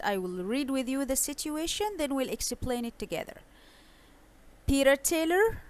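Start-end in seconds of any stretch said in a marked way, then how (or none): 4.7-5.6 Taylor rising high as a question; questioning rise. Shown as a demonstration. Teacher calling a name with a question lift. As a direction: ask it, lifting on Taylor. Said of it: "because of the way he says the question".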